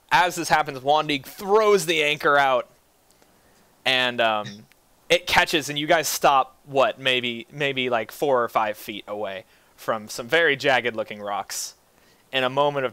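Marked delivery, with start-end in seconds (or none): none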